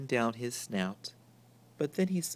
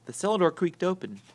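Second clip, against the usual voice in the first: ordinary delivery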